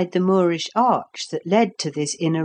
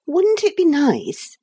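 put-on little girl voice; 10 semitones higher than usual. Lucy's voice.

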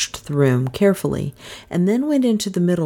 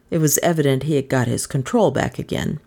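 ordinary delivery, nothing marked

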